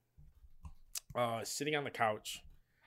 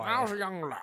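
put-on higher, louder hammy old-timer; old-timey storyteller; 9 semitones higher, 9 dB louder than usual. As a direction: ham up a quaint old yarn. Jokey childhood phrase.

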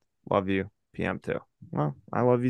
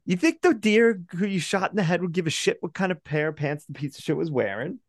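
high mocking voice, much higher; high mocking impression of a friend; mocking quote; 9 semitones higher than usual. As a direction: squeal it out in a high, mocking put-on voice. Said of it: "he's like".

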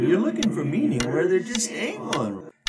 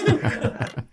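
chuckle; 6 semitones higher than usual. Acted. Laughs after nickname demand.

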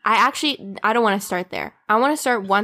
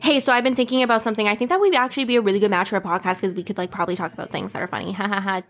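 rapid pitch; faster than usual. Retold or imitated. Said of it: "It was more like".

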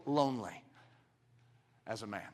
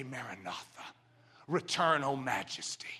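soft and low, almost breathed; hushed prayer; 5 semitones lower, 5 dB quieter than usual. Acted. Preacher praying for the Lord's return, hushed and low. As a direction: pray it softly, low.

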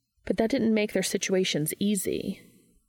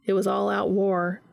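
ordinary delivery

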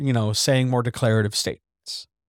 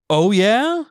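"Oh, yeah?" climbing high on "yeah"; loud, high rising "Oh, yeah?"; incredulous question; 8 semitones higher, 5 dB louder than usual. Shown as a demonstration. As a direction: challenge them with a rising "Oh, yeah?". Versus takes of one phrase.

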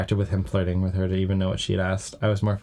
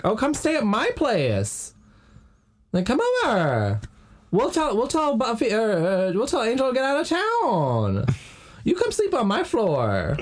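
high mock pleading voice; 12 semitones higher than usual. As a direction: beg sweetly in a squeaky voice. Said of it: "Xander's like".

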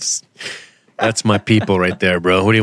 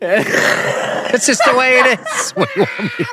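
high, cracking up mid-rant; high-pitched laughing exasperation; laughing protest; 12 semitones higher than usual. Real, not acted. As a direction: protest helplessly through real laughter. Joking rant breaking into laughter.

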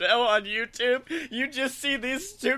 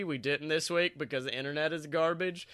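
ordinary talk

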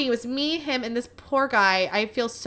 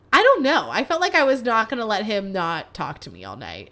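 ordinary talk

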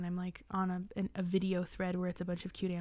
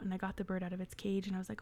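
ordinary delivery

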